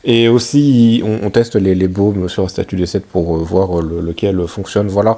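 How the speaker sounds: loud, fast recap voice; voice-over narration. Real, not acted. (voix off de narrateur)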